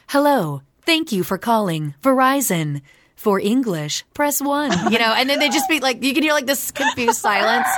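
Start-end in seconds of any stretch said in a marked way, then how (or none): 0.1-4.7 mock recorded phone menu voice; automated phone greeting, cheerfully robotic. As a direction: play the Verizon phone robot. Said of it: "And I'd be like".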